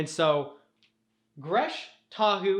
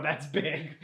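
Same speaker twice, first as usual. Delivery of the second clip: laughing. Real, not acted. Repeat of the joke, laughing.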